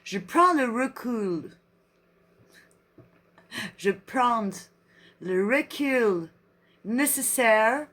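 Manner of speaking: slow French in a put-on English accent; drawn-out mock-English accent. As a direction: read like an English speaker, word by word. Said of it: "Non, l'anglais".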